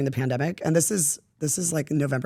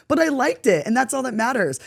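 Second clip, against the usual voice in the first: ordinary talk, nothing marked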